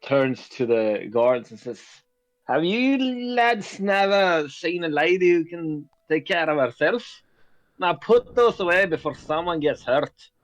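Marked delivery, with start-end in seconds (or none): 2.5-10.3 high-pitched, scolding lady; scolding character voice. Acted. Gnome lady tells her guards to stand down, scolding. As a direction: tell them off in a high put-on voice.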